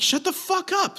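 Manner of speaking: cutting her off in fury; retold angry yell. To the woman telling him to stop yelling, a high angry yell. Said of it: "I'm yelling at these people".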